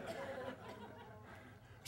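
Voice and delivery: breathy laugh through the words; can't hold back the laugh. Real, not acted. Laughing while retelling the boat story to Rick.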